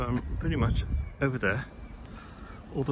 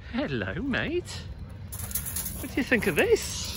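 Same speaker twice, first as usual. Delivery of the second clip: excited dog talk; 10 semitones higher than usual. Real, not acted. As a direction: coo over the dog like a delighted owner.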